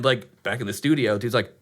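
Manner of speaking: ordinary delivery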